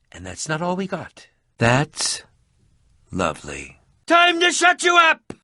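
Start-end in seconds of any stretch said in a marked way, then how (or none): 4.1-5.3 high shouted taunt; battle cry. Acted. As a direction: shout it at the enemy. Battle taunt in a game fight.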